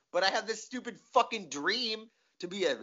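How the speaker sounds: ordinary talk, nothing marked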